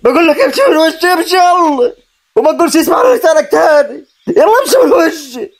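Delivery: high, heated order to leave; high-pitched, insistent fury; furious dismissal. Acted. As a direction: throw him out in anger. Orders his cousin to leave.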